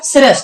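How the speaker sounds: श्रेष्ठ opening on a joined "shr"; correct pronunciation. Shown as a demonstration. Teacher introduces the word, correct pronunciation. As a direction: say श्रेष्ठ as one syllable, "shresht". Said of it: "इसको हम सही से बोलेंगे".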